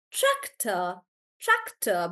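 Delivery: tractor slowly, weight on TRAC; stress on TRAC. Shown as a demonstration. Teacher explaining why she sounds like AI, tractor stressed on TRAC. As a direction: lean into TRAC, let tractor fall. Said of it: "stressing on the right syllable".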